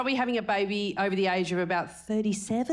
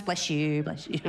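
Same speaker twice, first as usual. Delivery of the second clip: mock churchy blessing; 5 semitones lower than usual. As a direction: bless them like a vicar. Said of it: "They're like".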